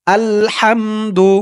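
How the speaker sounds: drawn-out chanted Arabic; slow recitation. Shown as a demonstration. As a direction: stretch the word in a recitation tune. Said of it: "আরবিতে আগে আমরা শিখি". (ধীরে টেনে পড়া)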